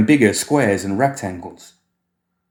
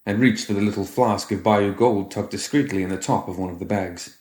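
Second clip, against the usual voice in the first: plain reading voice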